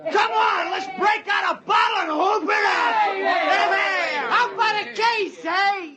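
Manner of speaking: rally everyone to drink; excited shouting. Acted. Drunken crew celebrating loudly.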